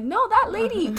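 high-pitched imitated objection; squeaky-high quoted comeback; retold protest. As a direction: mimic an insistent reply way up high. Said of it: "And you're like".

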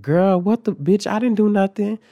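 high, loud squawk of innocence; indignant protest. Retold or imitated. Wrongly accused student protesting to class, indignant protest.

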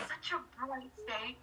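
usual speaking voice

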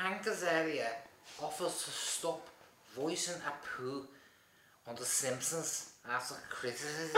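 putting on Scouse to read aloud; Liverpool accent. Shown as a demonstration. Reading a headline on request, in a mock Liverpool accent. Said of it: "read that headline in a Liverpool accent".

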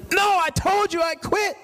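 high mock retort; hammed-up high indignant reply; indignant young man. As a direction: snap back like a sulky teen.

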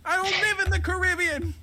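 put-on high kid voice; upset kid. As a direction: complain like a frustrated kid. Kid upset about gift card.

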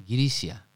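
Grisia said slowly as "gi-ri-sha"; Japanese name pronunciation. Shown as a demonstration. Host giving the Japanese name for Greece, slow Grisia. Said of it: "la gente no entendía Grecia".

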